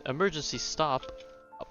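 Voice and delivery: ordinary talk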